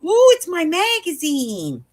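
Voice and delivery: high, drawn-out delighted squeal; excited. Retold or imitated. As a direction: coo with glee over long-awaited mail. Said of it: "I was excited".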